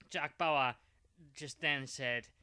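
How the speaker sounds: usual speaking voice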